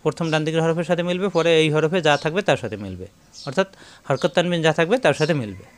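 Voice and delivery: usual speaking voice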